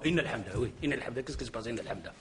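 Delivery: Inna al-hamda said as a cut-off chunk, dropping low and soft; mock wrong pause. Shown as a demonstration. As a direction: stop dead after al-hamda as if the sentence were over. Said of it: "Pourquoi t'as fait une virgule là?".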